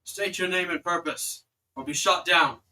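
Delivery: loud, commanding sentry voice, building in force; guard's challenge. Acted. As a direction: challenge strangers from the wall. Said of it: "you hear a voice call out".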